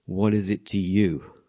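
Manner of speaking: slow, measured rebuke; slow calm rebuke from Jesus; slow Jesus reply. Retold or imitated. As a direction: lay each word down slowly. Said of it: "Jesus' response was".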